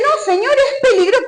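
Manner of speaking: high, anxious plea; pleading refusal. Retold or imitated. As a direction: beg God not to make you do it. Alpinista discute con Dios. (súplica aguda y angustiada del alpinista a Dios)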